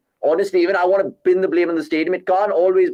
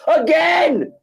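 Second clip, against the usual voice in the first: furious shout; 9 semitones higher, much slower than usual. Real, not acted. Fan yelling at the bowler on TV, furious. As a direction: shout it out, much higher in pitch.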